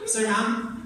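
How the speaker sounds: loud, high mock Filipino accent. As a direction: call it out in an exaggerated Filipino accent. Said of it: "In the weird-ass Filipino accent".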